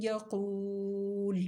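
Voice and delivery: یقول very slow with the final ل stopped silent; pause form recitation. Shown as a demonstration. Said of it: "ساکن کر دیا لام کو". (وقف کے ساتھ ساکن لام)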